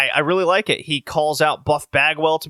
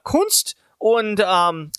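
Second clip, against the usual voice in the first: mock German heel promo; 6 semitones higher, much slower than usual. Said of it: "he just goes".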